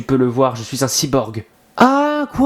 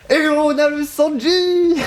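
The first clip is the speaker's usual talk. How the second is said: excited; 13 semitones higher, slower than usual. Real, not acted. Hyped reaction to a new character.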